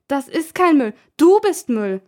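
high, loud angry comeback; girl snapping back at her teasing brother; indignant retort. Acted. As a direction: throw the insult right back.

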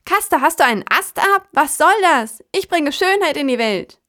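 hohe, laute Krähenempörung; entrüstet wie ein kleiner Vogel; empörte Krähe. Acted. Kraja empört über das Laub. (empörte Krähenstimme)

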